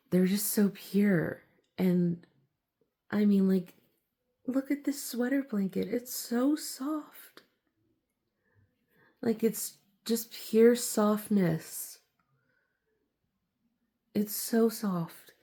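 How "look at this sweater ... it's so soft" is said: soft cooing. Real, not acted. Rubbing soft sweater blanket.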